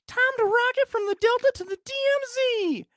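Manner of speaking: very high, loud movie-quote shout; radio DJ holler. Acted. Host quotes a film line for quiz, exuberant DJ yell. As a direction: belt it out like a wild radio host.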